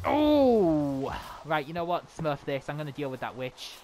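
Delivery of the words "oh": long, loud high "oh" falling away; drawn-out high cry, sliding down; startled cry. Real, not acted. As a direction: yell out in dismay. Cries out in the fight.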